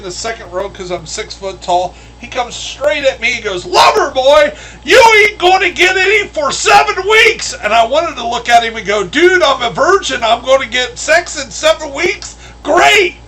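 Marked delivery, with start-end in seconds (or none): 3.6-7.5 high, loud barking drill sergeant; a full-force boot camp yell in the face; drill sergeant shout. Retold or imitated. Drill sergeant yelling at recruit. 9.0-13.2 loud, high-pitched sarcastic glee; imagined cheeky comeback, loud and high; mock excited retort.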